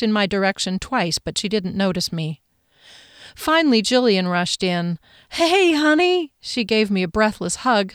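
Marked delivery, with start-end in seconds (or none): 5.3-6.3 high, slow bright "Hey, honey!"; cheery sister greeting. Acted. Sister greets narrator warmly.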